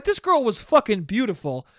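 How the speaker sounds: usual speaking voice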